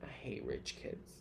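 unvoiced, slow whisper; whispered aside. Real, not acted. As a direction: breathe it under your breath. Muttered aside.

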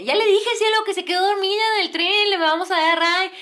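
high, loud flustered retelling; nervous husband. Retold or imitated. Nervous man justifying the ride to cielo, imitated fluster. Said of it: "Se ponía nervioso".

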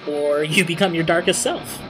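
ordinary delivery

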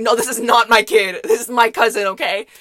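loud, insistent mock protest; forceful acted-out clarification; emphatic denial. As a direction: set the teacher straight, loudly. Imagined firm explanation to teacher.

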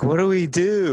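high, panicked question; mock high-pitched wife asking what to do; helpless drunk wife. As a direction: imitate the tipsy wife asking. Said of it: "So my wife's like".

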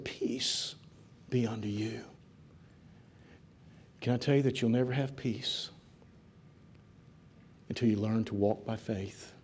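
soft, low and spaced out with pauses; hushed preaching appeal. Real, not acted. Preacher on finding peace through trust, hushed appeal. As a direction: speak it gently down, phrase by phrase.

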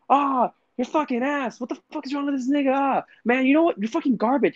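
high-pitched kid ranting in mock fury; aggressive kid gamer. Kid insulting his teammate, high aggressive rant. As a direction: imitate an angry little boy trash-talking. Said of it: "how aggressive this ten to twelve-year-old kid".

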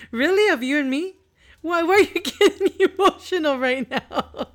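amused disbelief, laughing; laughing tease. Real, not acted. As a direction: tease him while cracking up. Teasing son, laughing.

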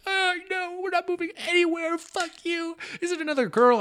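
high-pitched mock-bawling girlfriend; squeaky-high retold tearful protest. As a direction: imitate a crying girlfriend, voice way up high. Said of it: "So now she's bawling".

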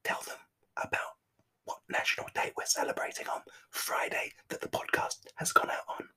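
soft hushed whisper. Pretend. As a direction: drop to a whisper. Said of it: "start to whisper".

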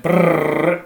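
rolled lips tire rumble noise; mock vibration noise; imitated rumble. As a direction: buzz your lips like a shaking car.

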